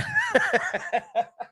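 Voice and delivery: high-pitched burst of laughter; real cackling laugh. Real, not acted. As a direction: laugh out high after the act. Laughs at own preacher act.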